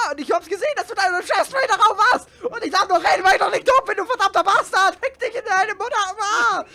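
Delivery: loud accusing tirade; angry rant. Real, not acted. Player rants loudly at the friend who killed him. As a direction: tear into the friend who betrayed you.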